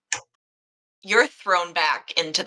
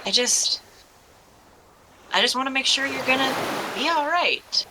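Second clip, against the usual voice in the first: lightly acted concern, higher pitch; gentle concern; 7 semitones higher than usual. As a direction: raise the pitch in tender worry, quickly. Worried young man before partner's dive.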